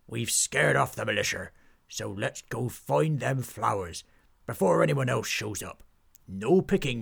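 put-on brief the lads like an old sea dog; pirate captain. Pirate captain sending his crew flower hunting.

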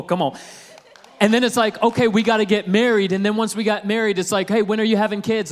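ordinary talk, nothing marked

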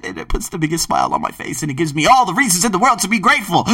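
ordinary delivery

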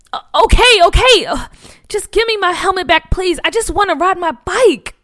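high, loud, stumbling plea; desperate yell. Acted. Boy yelling at the bullies to give back his helmet, desperate. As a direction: give in at the top of your voice. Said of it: "Nathaniel yelled".

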